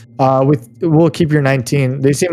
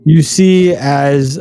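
ordinary delivery

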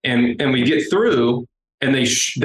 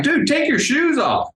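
much higher, strained urging; urgent retold plea. Narrator urging the man ahead in line, high and strained. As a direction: squeak it out like you're begging him to hurry.

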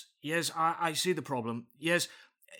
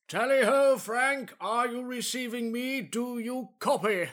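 hearty booming country squire; 7 semitones higher than usual. Acted. Actor calls to the booth.

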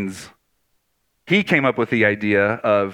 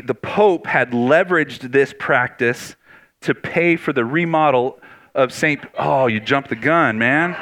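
ordinary delivery

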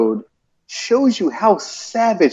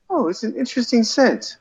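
high soft April voice; 9 semitones higher, 5 dB quieter than usual. Retold or imitated. Voicing April smelling flowers.

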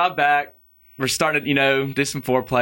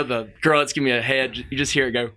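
usual speaking voice

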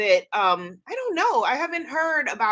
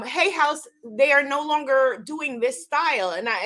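ordinary delivery

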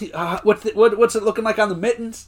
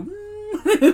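very high, thin whine; mock whimper. Michael overcome by Jim's praise, mock high whimper. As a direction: squeal like someone holding back tears. Imitates Michael's tearful reaction.

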